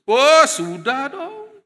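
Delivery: drawn-out, high, loud boast of "sudah dong"; cocky reply. Retold or imitated. Proud young man answering the student, loud and smug. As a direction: brush the question off, smugly sure.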